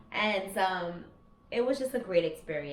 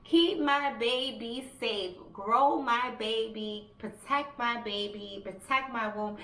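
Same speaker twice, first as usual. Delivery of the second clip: slow, drawn-out retold plea; insistent re-enacted prayer, word by word; pleading prayer; 3 semitones higher, slower than usual. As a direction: beg God one measured phrase at a time. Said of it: "praying to God".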